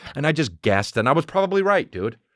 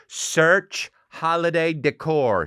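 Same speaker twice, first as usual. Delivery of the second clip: slow, higher, drawn-out command; voice search command; 6 semitones higher, much slower than usual. Retold or imitated. Mom talks to the TV remote, slow voice-command voice. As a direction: imitate someone dictating slowly to a TV remote. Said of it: "She'll do it like that".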